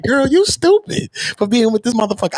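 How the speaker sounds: mock a friend telling you off; nagging friend. Friends warning about the boyfriend.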